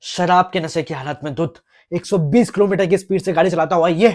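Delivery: ordinary talk, nothing marked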